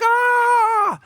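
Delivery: high, loud outburst; mock scream. Worrier venting on everybody else, high mock scream. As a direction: let out a big worked-up "Ah!". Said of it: "And you're just like".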